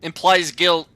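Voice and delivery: mock lawyer threat. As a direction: bluff like a smug litigant. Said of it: "You should have just been like".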